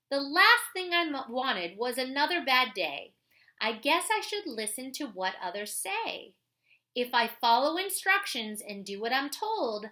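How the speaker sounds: put-on clear, lively read-aloud voice for kids; reading a picture book aloud. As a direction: read it like a story for the class. Reads a children's book aloud.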